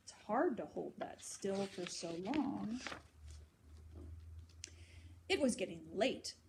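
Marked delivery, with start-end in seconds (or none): none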